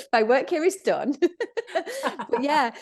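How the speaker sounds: said through a real laugh. Real, not acted. Laughing back at the host who now wants to do yoga. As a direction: giggle the words out, pleased.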